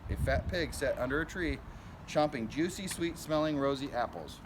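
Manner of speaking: usual speaking voice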